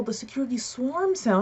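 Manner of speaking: normal talking voice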